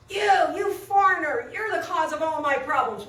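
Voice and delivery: put-on aggressive drunk. Drunk laborer accusing a foreigner on the train, aggressive. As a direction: lash out as a retold belligerent laborer. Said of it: "who's really aggressive".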